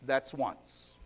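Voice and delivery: low, hushed and ominous; a calm, quiet warning. Acted. Wife calmly warns the stumbling mule, quiet and deadly. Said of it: "she said quietly".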